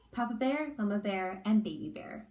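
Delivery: ordinary delivery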